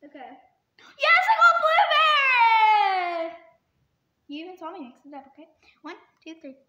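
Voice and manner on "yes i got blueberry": loud, high cry of relief; joyful shout. Real, not acted. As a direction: yell it out in triumph. Relieved at a good bean.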